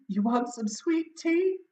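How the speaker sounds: put-on Southern belle accent, sweet and high. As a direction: offer it like a Southern hostess. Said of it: "a southern accent".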